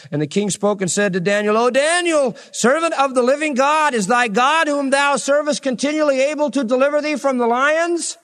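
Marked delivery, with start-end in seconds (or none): 1.6-8.2 high, pleading lament to the den; lamentable cry. Acted. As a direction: cry out anxiously for Daniel. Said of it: "he cried with a lamentable voice".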